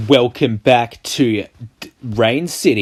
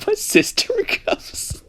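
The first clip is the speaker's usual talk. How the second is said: laughing; 6 dB quieter than usual. Real, not acted. Laughing squeak.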